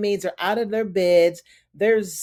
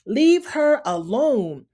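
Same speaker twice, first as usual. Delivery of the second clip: raised higher and louder, slow and firm; defiant shout; 5 semitones higher, 4 dB louder, slower than usual. Retold or imitated. Moira standing up to Lydia, higher, louder, slow defiant call. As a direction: call out over the room to stop her. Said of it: "Moira stands up and she's like".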